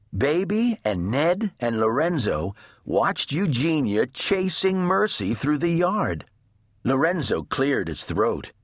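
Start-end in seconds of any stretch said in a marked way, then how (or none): none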